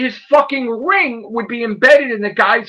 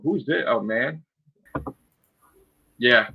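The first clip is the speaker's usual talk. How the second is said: usual speaking voice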